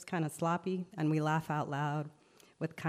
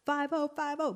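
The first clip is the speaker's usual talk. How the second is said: high, urgent "Five oh, five oh"; warning call; 10 semitones higher than usual. Acted. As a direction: call out to warn everyone the cops are coming. Warning that police are coming.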